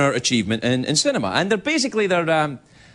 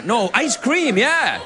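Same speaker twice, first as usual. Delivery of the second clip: mock gusto; 7 semitones higher, much slower than usual. Corrects himself with mock gusto.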